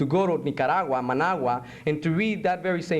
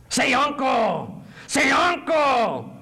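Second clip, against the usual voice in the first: loud, high, drawn-out command; torturer demanding surrender; 9 semitones higher, 4 dB louder, much slower than usual. Acted. Interrogator forcing a prisoner to give in, loud slow shout. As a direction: shout it slowly, twice, like an order.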